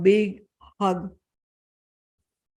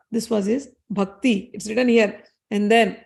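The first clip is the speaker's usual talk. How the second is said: usual speaking voice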